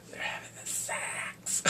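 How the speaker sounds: slow, hushed mock-scandalous whisper; naughty whisper. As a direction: whisper it like a juicy secret.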